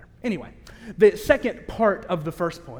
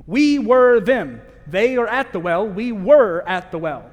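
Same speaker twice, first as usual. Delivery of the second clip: preaching emphasis; 7 dB louder than usual. Acted. Preacher driving home point.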